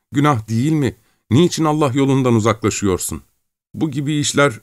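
high-pitched wailing entreaty; tearful pleading. Acted. Terrified villager begging his captor and God, tearful pleading. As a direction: plead in despair, voice pushed high. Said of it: "Bir yandan da ağlıyordu".

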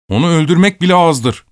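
high, forceful bitter verdict; furious. A narrator's reading. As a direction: spit it out with hatred. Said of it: "gözlerinden öfke kıvılcımları saçarak".

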